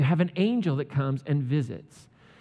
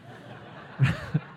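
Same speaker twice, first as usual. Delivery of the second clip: high breathy giggle. Real, not acted. Laughs at mute joke.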